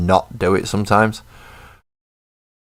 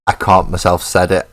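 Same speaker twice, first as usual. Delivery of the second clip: ordinary talk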